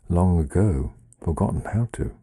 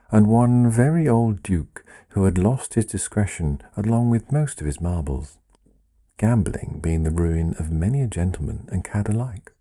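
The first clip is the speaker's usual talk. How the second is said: plain reading voice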